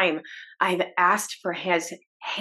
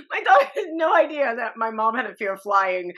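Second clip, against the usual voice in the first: ordinary talk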